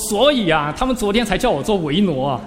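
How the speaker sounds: high and loud, 叫 stressed with glee; delighted boast. Retold or imitated. Young monk bragging to his master, thrilled and loud. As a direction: crow proudly about the new job. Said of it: "我乐得要命".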